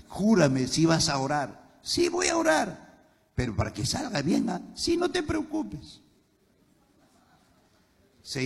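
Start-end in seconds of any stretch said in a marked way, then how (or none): 1.8-2.9 high mock-sweet wife voice; lightly voiced wife, pitched up; a hint of the wife reassuring him; wife's promise. 4.7-6.0 high mock-sweet wife voice; lightly voiced wife, pitched up; a hint of the wife reassuring him; wife's promise.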